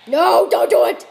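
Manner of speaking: loud plea breaking into a high cry; pleading yell. Real, not acted. Player begging the opponent not to kick, loud yelp. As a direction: beg the opponent to stop, then yelp.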